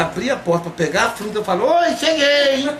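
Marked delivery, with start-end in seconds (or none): 1.7-2.7 high, slow, sing-song hello; sweet greeting. Retold or imitated. Heloísa greeting her sister at the door, high slow sugary hello.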